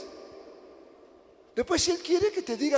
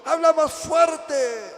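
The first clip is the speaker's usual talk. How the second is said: loud, slow, higher-pitched demand; drawn-out acted shout asking for more volume; mock hard-of-hearing shout; 5 semitones higher, 8 dB louder, much slower than usual. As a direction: call out as if you can't hear. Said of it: "Parecemos sordos nosotros".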